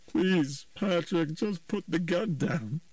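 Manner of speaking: high, slow begging; mother begging her son to come home; desperate mother. A narrator's reading. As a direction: plead on your knees. Nancy begs on her knees.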